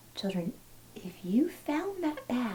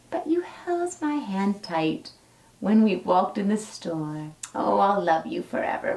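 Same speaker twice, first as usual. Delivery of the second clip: gentle, lilting storybook read-aloud; reading to children; 4 dB louder than usual. Acted. Teacher reading a dinosaur story to her class, storybook lilt. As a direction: read it like a bedtime picture book. Reads a picture book to children.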